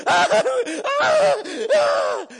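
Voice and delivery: high, loud mock panic shout with a laugh; panicked cry. Preacher mimics frightened disciples in the storm, hammed-up panicked shout. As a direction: squeal out the disciples' terror, hammed up. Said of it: "stood around and went".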